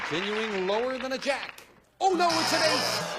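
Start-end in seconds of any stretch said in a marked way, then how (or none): 2.0-3.2 high-pitched cry of bad luck; dismayed exclamation. Real, not acted. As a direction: react big to the losing card. Host reacts to losing card.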